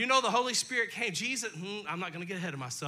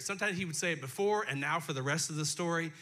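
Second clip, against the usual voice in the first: usual speaking voice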